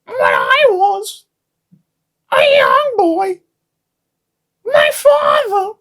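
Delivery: very high, slow squawking bird croon; shrieking high bird wail breaking into a cry; emu emo singer. Pretend. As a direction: sing like an emu fronting an emo band. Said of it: "How do I even do an emu accent as an emo singer".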